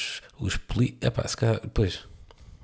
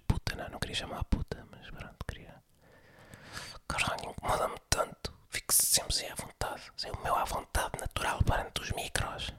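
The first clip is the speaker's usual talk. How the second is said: hushed, irritated whisper under the breath; annoyed whisper; 12 dB quieter than usual. Real, not acted. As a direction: whisper a private grumble so the neighbour won't hear. Irritated aside about coughing neighbour. (sussurro irritado, entre dentes)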